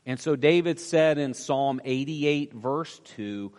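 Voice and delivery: usual speaking voice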